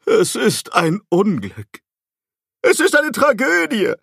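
booming, despairing cry, rising high; dark lord. A narrator's reading. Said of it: "rief Robert Archibald verzweifelt mit dröhnender Stimme".

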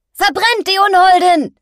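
sehr hoher, lauter Schrei eines Lehrlings; geschrien. A narrator's reading. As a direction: den Mob mit gellendem Schrei anstacheln. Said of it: "schrie ein Lehrling". (lautes, hohes Schreien)

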